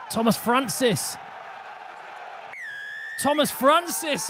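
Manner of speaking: loud, excited live match commentary; play-by-play. Real, not acted. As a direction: call the action at full pitch.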